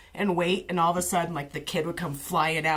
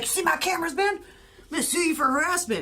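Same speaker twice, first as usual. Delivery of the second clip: imitating a neighbor; 10 semitones higher than usual. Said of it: "he's like".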